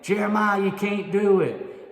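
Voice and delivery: high, loud mocking call down the hole; taunting frogs. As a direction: jeer at him from the top of the hole. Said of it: "the frogs started saying".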